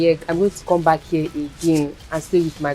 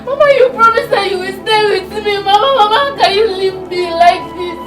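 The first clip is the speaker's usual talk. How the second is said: high sobbing wail, half sung; wailing sobs; 12 semitones higher, 5 dB louder than usual. Acted. Girl wailing over her dead grandma, sobbing. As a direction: cry out for help through tears.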